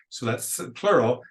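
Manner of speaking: ordinary delivery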